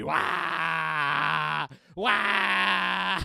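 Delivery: loud sustained "Wah!" wails; full-throated yelling; game scream. Pretend. Player screaming to survive the round, wailed screams. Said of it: "screaming to survive".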